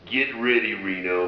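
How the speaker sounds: big concert-commercial voice; commercial announcer voice. Retold or imitated. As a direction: imitate an event announcer hyping the crowd. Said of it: "he did that voice".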